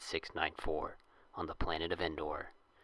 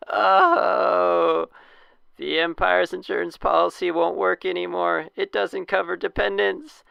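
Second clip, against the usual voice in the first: high, loud dying wail; wounded soldier gasping; 12 semitones higher, 13 dB louder than usual. Acted. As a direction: moan it out like a dying man. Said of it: "For a dying man he's got a lot of life left".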